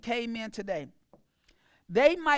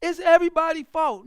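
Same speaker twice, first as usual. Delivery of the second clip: whiny complaining; 4 semitones higher, 7 dB louder than usual. Retold or imitated. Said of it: "what you'll be like".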